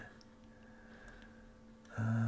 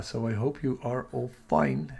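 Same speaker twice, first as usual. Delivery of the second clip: ordinary delivery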